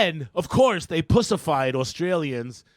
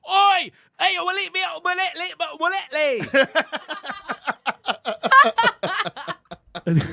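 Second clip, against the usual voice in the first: high put-on Aussie voice, laughing at the end; 13 semitones higher, 5 dB louder than usual. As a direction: ham up a rough Australian challenge. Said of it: "step up and be like".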